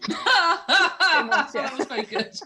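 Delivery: slow, laughing through the praise; can barely get the words out for laughing. Real, not acted. Friend cracking up at the host's womb joke, laughing.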